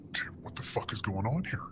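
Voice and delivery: whispered, under the breath; hushed whisper. Retold or imitated. Medic whispering to himself as the patient drops, hushed. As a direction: mutter it to yourself, alarmed. Retelling panic in the ambulance.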